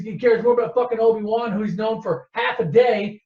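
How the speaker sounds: usual speaking voice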